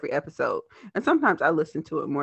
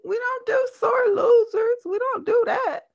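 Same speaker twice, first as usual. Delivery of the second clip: playful scolding. Acted. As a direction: scold with a high whine. Teasing scold.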